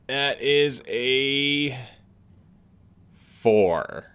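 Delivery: slow, drawn-out dice reveal; dramatic reveal. Acted. Reading the die roll to viewers, slow and dramatic. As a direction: stretch it out, then land the number. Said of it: "it's more dramatic with a D six".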